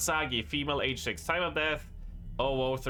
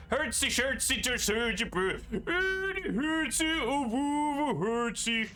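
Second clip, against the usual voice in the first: high, sing-song Muppet chef gibberish; bork-bork nonsense in a high chef voice; Swedish Chef voice; 11 semitones higher than usual. Acted. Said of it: "reads exactly like the Swedish chef voice".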